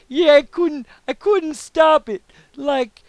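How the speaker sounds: high, loud and slow put-on drawl; telling a coworker he couldn't stop the jam, in a high silly voice; John Travolta impression. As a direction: ham up a cartoonish Simpsons-style Travolta. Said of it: "that's just my John Travolta".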